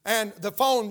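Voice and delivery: ordinary delivery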